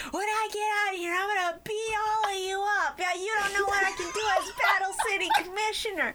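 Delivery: put-on high bratty kid threat; mock Mokuba. Mokuba yelling at captors, high bratty voice. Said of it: "in the back going".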